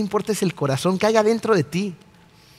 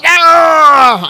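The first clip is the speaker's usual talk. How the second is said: sudden loud angry ah, pitched up high; road rage yell; 10 semitones higher, 10 dB louder than usual. Retold or imitated. Preacher acting a driver cut off in traffic, yelling a furious ah.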